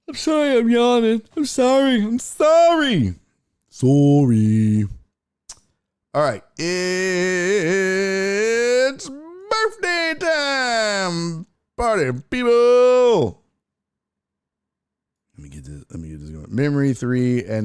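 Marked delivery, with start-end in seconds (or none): 0.1-3.2 high, loud, hammed-up plea; over-the-top high-pitched sorry; mock wailing apology. 3.7-4.9 mock apology. Last of mock apologies, drawn out. 6.6-13.4 high, drawn-out hype shout; hammed-up birthday fanfare, very slow and high; party announcer.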